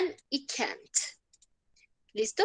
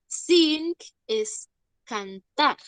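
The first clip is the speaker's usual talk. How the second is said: very slow, each word laid out; teacher explaining "Sing" slowly; slow teaching; 3 semitones higher, much slower than usual. Shown as a demonstration. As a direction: spell it out slowly for the class. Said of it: "Son diferentes".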